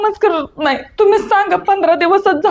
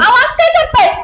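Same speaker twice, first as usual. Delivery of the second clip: upset protest; 7 semitones higher than usual. Acted. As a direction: push back hard at her. Daughter-in-law protesting.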